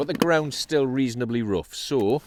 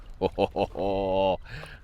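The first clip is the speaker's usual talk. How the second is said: drawn-out "oh-ho-ho" laugh; admiring chuckle; 3 semitones lower, 5 dB quieter than usual. Real, not acted. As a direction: chuckle at the size of it. Admiring a bigger fish.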